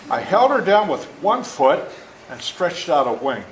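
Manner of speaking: usual speaking voice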